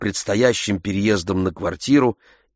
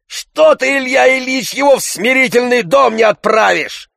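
Tarantyev still shouting; 6 dB louder than usual. A narrator's reading.

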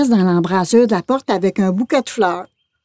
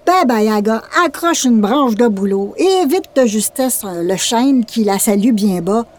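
usual speaking voice

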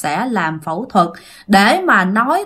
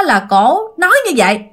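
ordinary talk, nothing marked

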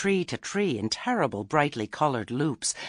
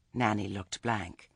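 usual speaking voice

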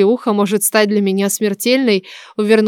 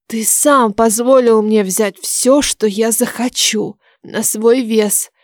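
slow, measured retort; deliberate retort; slower than usual. A narrator's reading. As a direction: spell it out slowly and pointedly. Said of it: "отчеканила я шёпотом и добавила". (медленно, с расстановкой)

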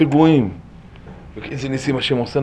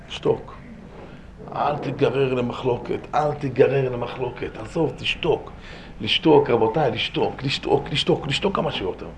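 hushed urging; 8 dB quieter than usual. Real, not acted. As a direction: press the advice gently, voice held right down. Softly urging listeners to keep silent. (הפצרה שקטה בקול נמוך)

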